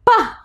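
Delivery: "pa" as one short popped syllable; letter-sound demo. Shown as a demonstration. Teacher showing children how popcorn pops, short popped "pa". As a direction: pop out a crisp "pa". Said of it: "How does the popcorn pop?".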